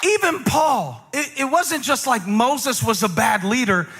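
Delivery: high, slow, ringing sermon climax; full-force preaching. Acted. Pastor preaching to the congregation, high and slow.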